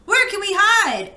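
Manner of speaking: high, worried plea; anxious question. Acted. Gork fleeing the robot, asking anxiously where to hide. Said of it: "asked Gork".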